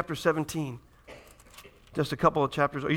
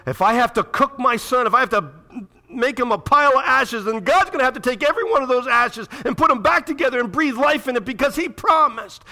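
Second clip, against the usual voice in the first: fervent preaching; 7 semitones higher, 5 dB louder than usual. Acted. Voicing Abraham's faith, preaching.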